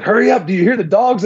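high, pressing imitation of a caller; urgent hurrying. Hunting partner phoning the straggler behind him, urgent. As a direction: quote him rushing you, pitch high.

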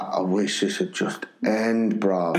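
low, slow aside from a bored teacher; bored grumble. Retold or imitated. Bored teacher turning to his colleague in assembly, low weary grumble. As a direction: mutter it low, fed up with assembly. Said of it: "he used to turn to me like".

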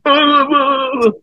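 long, high stuck vowel; mocking stammer. Pitcher mocking the speechless heckler, exaggerated stammer. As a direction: mimic a stutterer stuck on one drawn-out sound. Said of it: "fucking mumbling".

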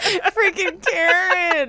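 high, slow, laughing through it. Real, not acted. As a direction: squeal it while cracking up.

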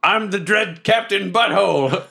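dread pirate captain. Acted. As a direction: proclaim it like a self-made pirate lord. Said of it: "I put thaumaturgy on and I yell".

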